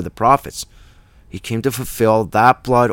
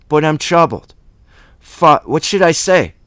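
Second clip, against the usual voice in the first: higher, louder, mildly troubled outburst; 5 semitones higher, 6 dB louder than usual. Acted. Preacher voicing Jesus before his hour, troubled. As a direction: act out a troubled soul, voice lifted. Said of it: "his intellect is troubled".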